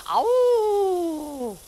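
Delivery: high howling cry; dog-like howl; coyote howl. Acted.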